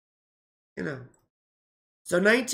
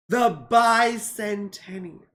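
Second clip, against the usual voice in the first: bicentennial slow and loud; grand emphasis; 2 semitones higher, 7 dB louder, much slower than usual. Real, not acted. Vlogger to viewers, proclaiming bicentennial slow and loud. Repeating slowly and loudly.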